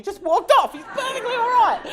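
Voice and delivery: high, loud, breaking up with laughter; real laughter bubbling through the words; laughing. Real, not acted. Breaks up at her own punchline.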